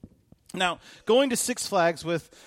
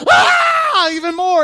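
very high, loud imitated scream; stuck-pig scream; 14 dB louder, much slower than usual. Pastor imitating Billy screaming like a stuck pig. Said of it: "He's screaming like a stuck pig".

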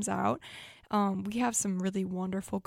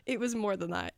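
normal talking voice

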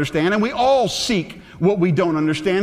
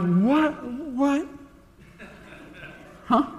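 mock-baffled, pitch rising on each word; dumbfounded awe; 7 semitones higher, 6 dB quieter, slower than usual. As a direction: act out being stumped, in short rising questions. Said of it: "just sit there in awe".

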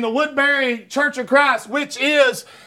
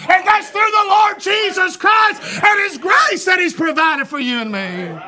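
fiery preaching; 5 semitones higher than usual. Real, not acted. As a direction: preach it out to the congregation. Climax of retold argument, preaching.

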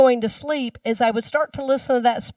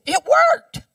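excited Rod; 5 dB louder than usual. Retold or imitated. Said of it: "Rod says to me".

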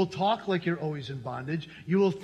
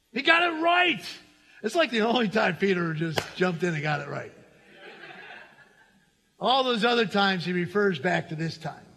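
normal talking voice

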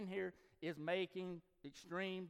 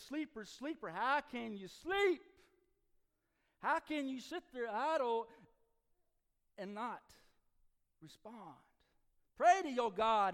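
loud high urgent cry; frantic crew shouting over the storm; shouting sailors; 9 semitones higher, 10 dB louder than usual. Acted. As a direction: yell at the sleeper to wake up. Sailors crying out to Jonah.

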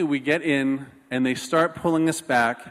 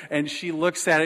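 ordinary delivery

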